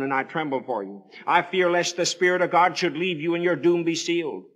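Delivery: ordinary delivery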